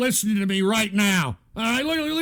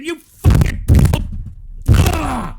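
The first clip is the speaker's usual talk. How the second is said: real, much louder, high screamed outburst; enraged scream; 5 semitones higher, 9 dB louder, slower than usual. Real, not acted. Streamer boiling over at his chat room, screaming in fury. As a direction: explode into a raw yell.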